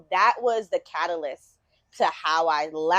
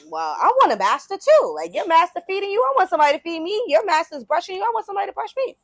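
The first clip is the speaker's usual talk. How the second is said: high, rapid, insistent wanting; eager, envious wolf; 10 semitones higher, 5 dB louder, faster than usual. Acted. Wolf wanting a master like the dog's, eagerly envious. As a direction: play an envious wolf piling up demands. Acting the wolf envying the dog.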